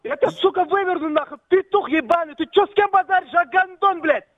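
high, raging threats; furious shouting. Real, not acted. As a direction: yell it in fury. Furious threats in phone quarrel.

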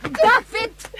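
very high, loud call of his name; very high, loud stop-it shout; sharp shout. Acted. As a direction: cut into the fight with his name. Mother stops the boy.

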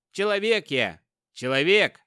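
loud, ringing proud declaration; proud shout. Acted. Герой гордо отвечает кто он. (громко и гордо, выкрикивая)

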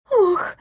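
sharp high-pitched gasp "ох". Acted. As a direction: gasp in alarm. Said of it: "Теперь уже охала Марлен".

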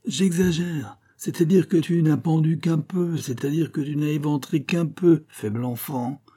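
high, incredulous retort; acted sarcastic outrage; scandalised irony. A narrator's reading. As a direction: throw the word back indignantly.

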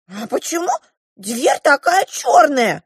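high, drawn-out dwarf question; butting-in dwarf. A narrator's reading. The dwarf interrupts about the drawing, prying. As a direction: butt in high, rising to loud. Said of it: "встрял карлик".

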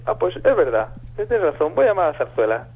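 chirpy Basque goodbye; imitated Basque accent. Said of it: "acento vasco". (vasca imitada, aguda, despidiéndose con agur)

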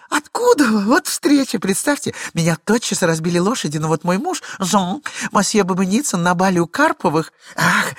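high, loud, breathless excuses; chattering lady. Acted. Glafira rattling off excuses to her husband, high and fast. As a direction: talk him dizzy with a tale.

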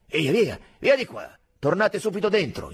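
loud, high military order; sharp command. A narrator's reading. Sergeant breaks up the crowd of soldiers, commanding loudly. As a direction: send them packing at once.

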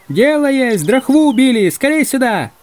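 high, urgent distant shout; calling out. Acted. Hunters summoning the other group, shouting urgently. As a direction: holler news and summon them over.

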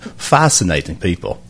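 loose Australian accent from the back of the throat. Shown as a demonstration. Doctor demos his new Australian accent to co-host. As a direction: show off the new Aussie accent. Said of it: "I learned how to do the accent".

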